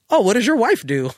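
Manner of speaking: high, loud prying neighbor; nosy neighbor voice. Retold or imitated. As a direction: play the curious suburban neighbor. Imitating nosy suburban neighbor.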